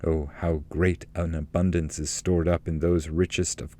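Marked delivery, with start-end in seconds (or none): none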